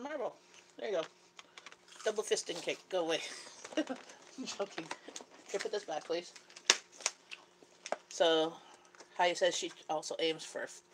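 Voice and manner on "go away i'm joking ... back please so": ordinary talk, nothing marked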